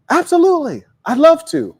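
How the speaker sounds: high, bright, delighted acceptance; thrilled to be asked out; eager yes. Acted. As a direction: say yes like it made her day. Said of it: "Major difference".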